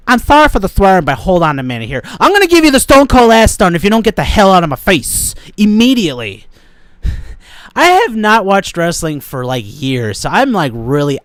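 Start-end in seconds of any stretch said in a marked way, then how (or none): 0.1-6.4 wrestler threat. Pretend. Telling the manager to get out of his face, loud wrestler threat. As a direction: threaten the boss like a ring villain. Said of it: "I'm telling my manager".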